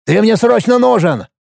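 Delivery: urgent, high-pitched plea; understated urgent yell. A narrator's reading. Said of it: "заорал Пашка".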